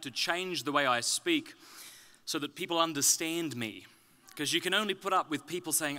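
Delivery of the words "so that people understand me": ordinary delivery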